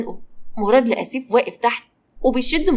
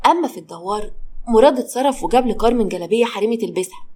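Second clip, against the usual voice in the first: ordinary delivery, nothing marked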